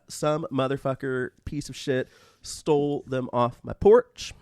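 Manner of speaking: normal talking voice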